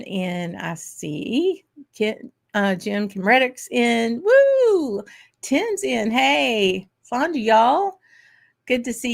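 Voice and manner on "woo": high, loud "woo" of delight; cheering whoop. Real, not acted. Host greeting a viewer joining the chat, cheering whoop.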